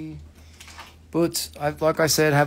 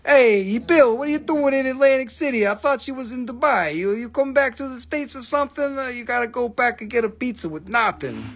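high, hammed-up East Coast American accent; mock US street accent, pitched up; put-on American accent; 6 semitones higher than usual. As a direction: call out like an Atlantic City local, accent laid on thick. Greets Bill as Atlantic City American.

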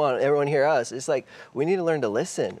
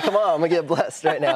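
laughing; 5 semitones higher, 3 dB louder than usual. Real, not acted. Joking about getting hit.

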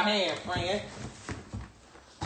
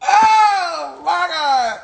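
mock wail; 10 semitones higher, 5 dB louder, much slower than usual. Dramatic reaction to package.

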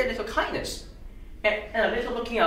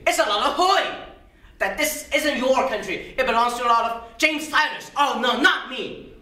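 raised, higher-pitched outburst; indignant protest; 3 semitones higher, 4 dB louder than usual. Acted. Mister Smith refuses to disillusion his boys, loudly indignant. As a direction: throw it out in scornful protest.

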